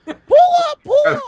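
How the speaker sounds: very high-pitched alarm voice; squeaky, frantic crash warning; mock cockpit warning. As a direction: imitate a plane's urgent pull-up alert. Mimics a crashing plane's warning.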